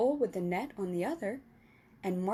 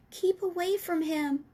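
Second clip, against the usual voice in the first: pleading; 9 semitones higher, 4 dB louder than usual. A narrator's reading. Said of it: "Sophie pleaded".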